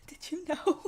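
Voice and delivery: hammed-up tearful wail; mock sobbing through the words; fake crying. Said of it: "I'm done crying".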